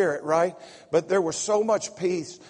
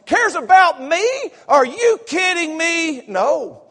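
high, loud, cries out the line; full-force preacher's cry; preaching shout; 13 semitones higher, 13 dB louder than usual. Real, not acted. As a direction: shout the climax to the congregation. Preacher's climax on God caring.

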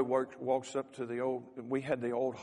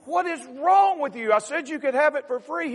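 exasperated owner; 13 semitones higher, 14 dB louder than usual. Acted.

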